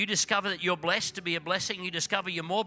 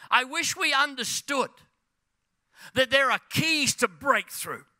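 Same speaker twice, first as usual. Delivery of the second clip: loud, raised, drawn-out preacher delivery; high-energy pulpit proclamation; full-force preaching; 7 semitones higher, 6 dB louder, slower than usual. Acted. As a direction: preach it out loud, stretching the key words.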